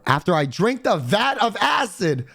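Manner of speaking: ordinary talk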